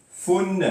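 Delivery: Fun pitched high as "founn"; tanwin damma. Shown as a demonstration. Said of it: "Rappelez-vous, N E". (prononcer Fun « founn », aigu, n sonore)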